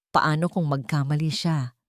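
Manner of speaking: ordinary delivery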